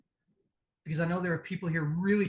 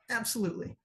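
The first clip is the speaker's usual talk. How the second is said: ordinary talk, nothing marked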